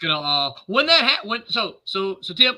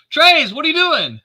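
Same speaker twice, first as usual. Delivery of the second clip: loud, high-pitched challenge to Trey's; yell; 11 semitones higher, 8 dB louder than usual. Acted. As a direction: yell it as you step into his path. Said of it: "in front of him and yell".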